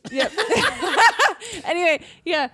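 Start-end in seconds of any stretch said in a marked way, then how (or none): 0.4-1.6 high, loud burst of laughter. Real, not acted. Big laugh.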